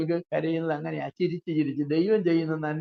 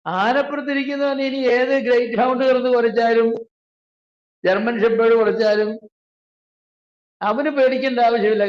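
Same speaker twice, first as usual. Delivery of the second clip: raised preaching; 6 semitones higher, 6 dB louder than usual. Real, not acted. Preacher building emphasis.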